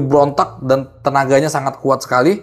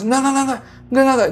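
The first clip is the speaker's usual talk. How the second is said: much higher rapid string of refusals; panicked refusal; 11 semitones higher than usual. Retold or imitated. Refusing the girl at the door, panicked and defensive. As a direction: fend her off in a frightened rush. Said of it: "gue defense".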